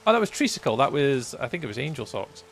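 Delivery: ordinary talk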